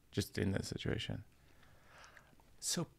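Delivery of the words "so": hushed hesitant Mulaney voice, mostly soft; a light Mulaney asking about the hair, quietly; John Mulaney impression. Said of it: "here's my impression of John".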